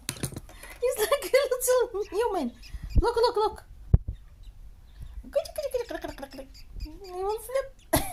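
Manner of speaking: high, slow, soft cooing. Real, not acted. Baby talk to the pets.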